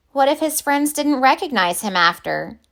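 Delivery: usual speaking voice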